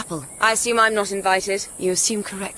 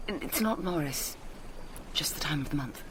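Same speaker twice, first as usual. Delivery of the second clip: quiet; 4 semitones lower, 10 dB quieter than usual. Acted. Embarrassed quiet admission.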